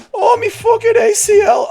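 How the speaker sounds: loud, very high mock British wail; bad British accent. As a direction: cry out in a hammed-up British accent. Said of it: "why can't I do British accents".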